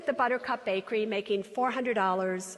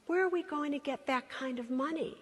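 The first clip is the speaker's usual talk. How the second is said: soft, tender motherly voice; gentle mother; 7 dB quieter than usual. Retold or imitated. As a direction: let her down gently, softly. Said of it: "And she says".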